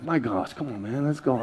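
low, soft grumble; muttering to himself. Retold or imitated. Man in airline line talking to himself, quiet impatient muttering. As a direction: grumble under your breath, impatient. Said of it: "I'm just talking to myself".

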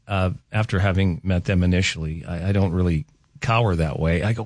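normal talking voice

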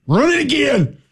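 high-pitched mock coach holler; O-line coach impression. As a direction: bark it like a Southern line coach. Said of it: "impersonate offensive line coaches".

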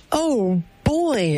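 thrilled contestant, pitch climbing high; excited game show winner. Retold or imitated. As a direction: squeal it like you just won big. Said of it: "Let me be the excited game show winner".

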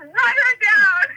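loud, high, drawn-out yell; hammed-up shout, stretched out; yelled order. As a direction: holler a reckless command. Cousin's order repeated, retold.

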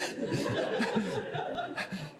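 short airy chuckle; breathy chuckle from the pulpit; soft breathy laugh. Real, not acted. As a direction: laugh quietly at the odd moment. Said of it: "I don't listen to people flushing".

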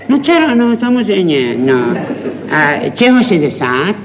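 fussy father quizzing the maternity ward; one side of a phone call, chuckling and nitpicking; pedantic new father on the phone. Acted. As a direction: pedant repeating every detail, half amused. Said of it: "je pedant".

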